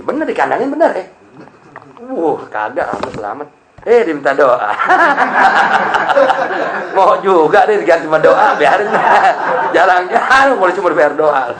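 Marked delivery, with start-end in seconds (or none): 4.8-6.9 breathy unvoiced laugh; burst of laughter. Real, not acted. Preacher cracking up at the cop asking for prayers, laughing. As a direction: laugh out at the joke.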